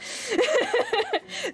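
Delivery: squealing high laugh; high giggle. Real, not acted. Streamer giggling to chat about the original hero. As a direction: burst into a delighted giggle.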